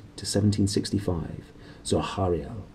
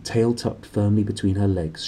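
usual speaking voice

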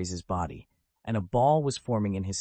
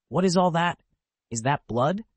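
shocked; 7 semitones higher, 5 dB louder than usual. A narrator's reading. Simeon sees blood-covered room.

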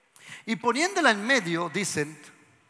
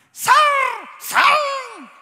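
shrill, very high mock yell; mock screaming exorcist; 12 dB louder than usual. Mocking fearful exorcists, high shrieked command. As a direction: ham up a panicked deliverance minister. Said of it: "gente que chilla más que los demonios".

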